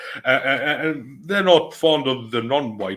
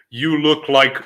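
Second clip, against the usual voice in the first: mock roasting call, higher and louder; roast opener; 6 semitones higher, 8 dB louder, slower than usual. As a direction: launch into a roast, punching it up high. Mimicking comedians' roasting opener.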